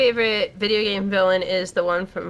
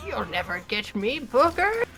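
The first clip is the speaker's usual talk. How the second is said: hammed-up ominous villain; 10 semitones higher than usual. Player mocking the game villain's taunts, hammed-up ominous voice. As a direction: crow it like a high-voiced prospector baddie. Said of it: "like, ominous voice stuff".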